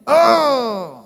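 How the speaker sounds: a high, loud mock yelp of pain. A patient yelps as the doctor squeezes his leg, hammed-up pained yelp. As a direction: cry out as the leg gets grabbed.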